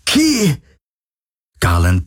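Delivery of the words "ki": high-pitched cry of a name. A narrator's reading. Galen calls to fallen Kirai, crying out high. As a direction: call out desperately, pitch leaping up.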